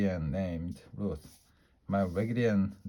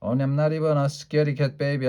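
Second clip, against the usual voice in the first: indignant; 5 semitones higher, 5 dB louder, faster than usual. A narrator's reading. Child insisting indignantly.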